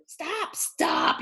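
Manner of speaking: high urgent hen cry. A narrator's reading. First hen crying out at the messy pigs, high and urgent. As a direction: hen flapping to stop the mess. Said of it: "cried the first hen".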